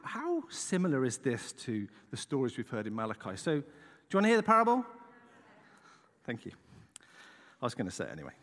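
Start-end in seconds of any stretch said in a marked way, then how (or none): 4.1-4.9 loud, lifted question to the crowd; calling out to the room. Real, not acted. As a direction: throw the question out to the congregation. Asks the congregation aloud.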